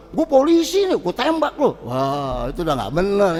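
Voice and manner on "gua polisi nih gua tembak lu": threatening policeman. Retold or imitated.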